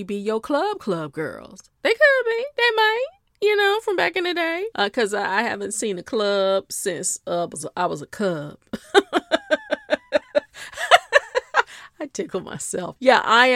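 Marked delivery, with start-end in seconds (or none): 1.8-4.7 squeaky high aside. Pretend. Playful squeak to the audience about old friends. 8.6-11.9 high cackling laugh at her own pun. Real, not acted. Laughing at her own cub pun to viewers.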